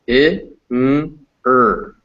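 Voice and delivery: SINGER split into separate sounds: short I, NG, ER. Shown as a demonstration. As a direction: sound out SINGER slowly, pausing between each sound. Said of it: "we can see it's pronounced".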